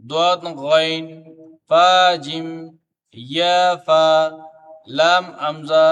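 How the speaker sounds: long held vowels on a level, chant-like note; paired-letter recitation. Shown as a demonstration. Teacher reading joined Arabic letters to pupils, measured drawn-out recitation. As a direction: recite each pair slowly and firmly, then leave a gap.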